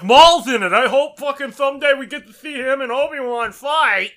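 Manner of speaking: high, loud blurting voice; loud spoiler jerk. Retold or imitated. Jerk blurting a Star Wars spoiler, loud and high. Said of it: "gonna be like".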